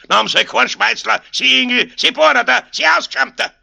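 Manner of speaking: fast, high, loud volley of insults; shouted scolding. A narrator's reading. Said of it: "rief Aloysius". (lautes bayerisches Geschimpfe)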